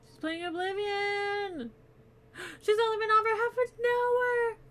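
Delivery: delighted gasp at seeing a friend online; excited gasps. Real, not acted. Spots friend streaming Oblivion.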